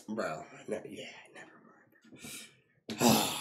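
slow breathy whisper; whispered never mind. Real, not acted. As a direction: drop it to a whisper. Gives up mid-sentence.

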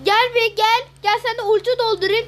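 loud, high call to come here. Real, not acted. Gamer calling a teammate over, loud call.

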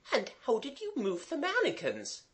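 high-pitched, softened governess voice; Mademoiselle. A narrator's reading. Governess asking about the trick, curious. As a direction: ask politely in a very high voice.

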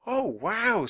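high-pitched wonder; amazed. A narrator's reading. Annie hears of the Mozart concert, amazed. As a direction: let out an impressed gasp of words.

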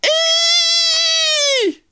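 very high, long "eee" squeal; acted scream. A narrator's reading. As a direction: squeal it out in mock fright. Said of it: "Maymay screamed".

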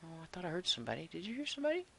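hushed, startled question; suddenly alert, quiet and quick. Real, not acted. Startled by a noise nearby.

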